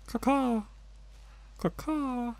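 high cawing crow voice; crow caws. Pretend. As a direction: caw like a crow to stay unnoticed. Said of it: "I'm a bird".

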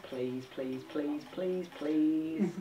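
soft, begging under breath; quiet pleading. Real, not acted. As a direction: plead softly to luck. Begging for a good bean.

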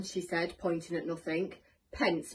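plain reading voice

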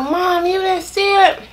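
high whiny complaint to mom; accusing mom. Retold or imitated. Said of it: "I was like".

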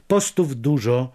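ordinary delivery

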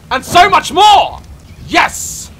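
roaring rallying speech. Real, not acted. Outro rallying.

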